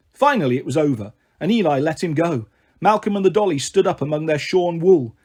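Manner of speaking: usual speaking voice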